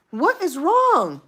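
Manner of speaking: high, loud, drawn-out complaint; exasperated outburst. Retold or imitated. Customer fed up with the store clerks, exasperated.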